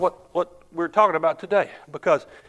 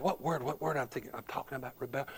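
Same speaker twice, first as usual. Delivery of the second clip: hushed inner thought; 3 semitones lower, 14 dB quieter than usual. Retold or imitated.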